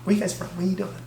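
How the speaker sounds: hushed mock stripper small talk; hushed imitation of stripper. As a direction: imitate her chatting quietly while dancing. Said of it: "She's doing her, like".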